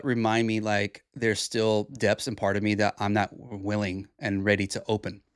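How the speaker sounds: normal talking voice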